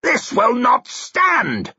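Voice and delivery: high, slow, outraged shout; indignant old lord's cry; angry old man. A narrator's reading. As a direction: shout it in protest. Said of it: "shouted an angry old man".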